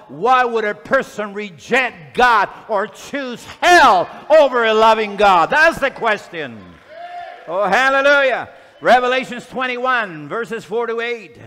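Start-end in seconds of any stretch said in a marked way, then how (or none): 0.1-10.3 loud, shouted pulpit challenge; impassioned preacher's shout; full-force preaching. Real, not acted. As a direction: thunder the question at the congregation, hitting key words hard.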